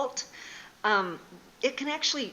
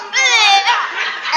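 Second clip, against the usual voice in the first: much higher, much louder mock howl through laughter; pained yell; 12 semitones higher, 16 dB louder than usual. Wife voicing her husband's yell at the hot rock. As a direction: howl like the rock landed on you.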